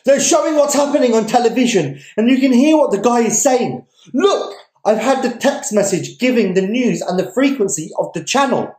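high, loud excited shouting; young man shouting news to the crowd, excited yell. Acted. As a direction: rave it out to the crowd. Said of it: "the ravings of one young man who is shouting".

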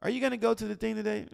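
high, quick mock question; casual asker. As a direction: toss it out high and chatty. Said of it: "in our watered down language, we say".

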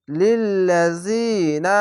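লিল্লাযিনা slowly, long "zee" before "na"; one-alif elongation. Shown as a demonstration. Teacher modelling a Quran word, long "zee" in লিল্লাযিনা, slowly. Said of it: "এক আলিফ টেনে পড়বেন".

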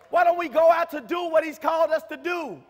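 P=A; shouted exhortation. Acted. Rhetorical question repeated, building up.

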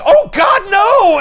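high, loud panicked cry of no; Spider-Man yelling in panic as he falls; panicked shout. Retold or imitated. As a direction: scream it as the building peels him off. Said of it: "Pete's like".

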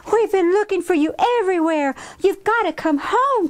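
high, quick, exasperated; urgent high-pitched scolding after a long search; frantic scolding. Acted. Kim finding her runaway sister, frantic scolding.

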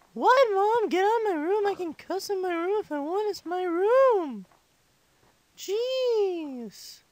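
put-on five-year-old complaining to mom, tailing off; exasperated child. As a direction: shoo mom away like an embarrassed kid. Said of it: "I talk like a five-year-old".

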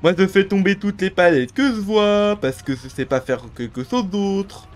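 dumb bot voice. Retold or imitated. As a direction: play a brainless bot player. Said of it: "C'est des bots".

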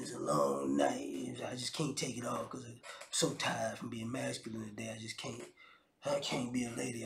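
exhausted macho voice. Retold or imitated. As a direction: groan it out like a worn-out tough guy. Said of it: "I'm still in my masculine frame".

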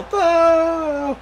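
high, laughing mock boo. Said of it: "pan back to the title".

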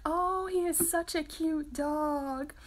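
high, drawn-out cooing imitation; hammed-up sing-out admiration; gushing dog admirers. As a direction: coo slowly over someone's cute dog. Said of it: "when people are always like".

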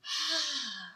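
a long, soft exhale to model calm breathing; calming sigh. Shown as a demonstration. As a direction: breathe out slowly so the child can copy. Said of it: "like that".

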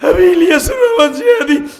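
loud preaching building to a high wail; impassioned tearful preaching. Real, not acted. Motherless preacher confessing to the crowd, tearful and impassioned.

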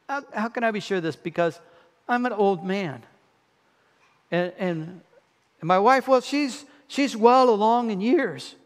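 a lightly voiced old man asking for proof; doubting old Zechariah. Zechariah questioning the angel, lightly acted doubtful old man. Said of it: "catch the inflection in his voice".

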